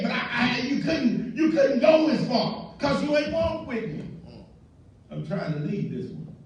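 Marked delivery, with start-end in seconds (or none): 0.0-4.0 raised, louder indignant voice; mock complaint. Preacher mimicking a complaining member, indignant. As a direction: whine like a slighted churchgoer.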